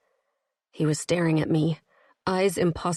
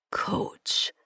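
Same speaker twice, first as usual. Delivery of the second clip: faint breathy name. A narrator's reading. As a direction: whisper her name weakly. Weak captive says her name.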